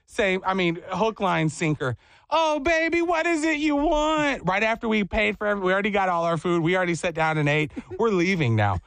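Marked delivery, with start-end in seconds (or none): 2.3-4.5 high, drawn-out doting lady voice; Miss Glenda. Retold or imitated. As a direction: coo it like a bakery lady to a child. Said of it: "Miss Glenda".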